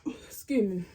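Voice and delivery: breathy whisper, excusing herself; whispered aside. Real, not acted. Aside after clearing throat.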